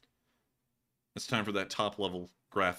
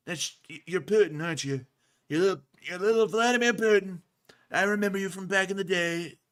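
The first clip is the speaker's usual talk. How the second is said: excited recognition in a brash tycoon voice; Trump impression; 7 semitones higher than usual. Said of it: "orange man enters".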